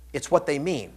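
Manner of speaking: ordinary talk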